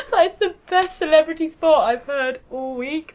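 high, loud, laughing praise; delighted laughing. Real, not acted. Friend reacting to a celebrity spot, delighted and laughing. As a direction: laugh through it, genuinely tickled.

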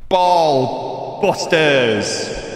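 hugely drawn-out title call; very slow, echoing announcer flourish; game-show announcer. Pretend. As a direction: stretch each word like a show jingle intro.

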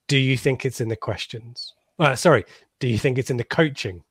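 normal talking voice

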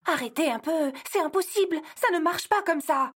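high insistent objection, stressing c'est impossible; exasperated. Acted. Elf argues with Santa that it's impossible, high and exasperated. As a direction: cut him off in exasperation.

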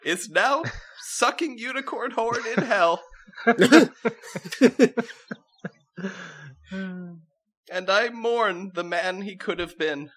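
high princess voice cracking into laughter; princess laughing through it. Acted. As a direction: try to stay regal but laugh.

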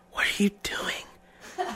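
slow breathy whisper; imitating her drowsy whisper. Drunk girlfriend whispering confused.

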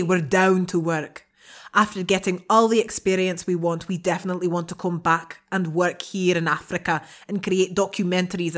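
emphatic brogue that drifts towards Scottish; attempted Irish accent. Co-host finishing a listener's dilemma for the panel, attempted Irish accent. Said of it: "That's a brilliant Scottish".

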